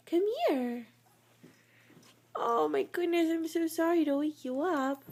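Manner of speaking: soft high doggy talk; cooing. Real, not acted. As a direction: coo over the yawning puppy. Cooing at sleepy dog.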